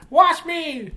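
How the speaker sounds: squeaky beard demanding chicken; the beard bossing its owner about food; talking beard voice. Acted. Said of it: "bird would be like".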